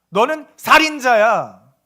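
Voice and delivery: loud, slow pronouncement; scripture's verdict. Acted. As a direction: hammer out the accusation word by word. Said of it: "성경이 우리에게 말하는 거예요". (크고 느리게 내리는 성경의 판결)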